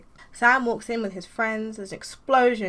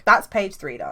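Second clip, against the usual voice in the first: ordinary talk, nothing marked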